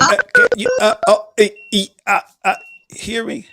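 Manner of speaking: high garbled glitchy voice; choppy broken audio. Retold or imitated. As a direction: mimic a call cutting out. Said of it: "you sound like".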